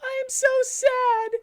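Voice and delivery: very high, slow, wailing mock cry; hammed-up crying. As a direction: stretch it out high like a whiny sob. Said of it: "said Amy in a crying way".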